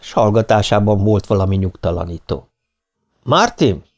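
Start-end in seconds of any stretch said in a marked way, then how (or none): 3.2-3.8 raised, high-pitched call. A narrator's reading. Ken summons Martin from the kitchen, calling out. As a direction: call him in from the other room. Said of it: "kiáltott Ken".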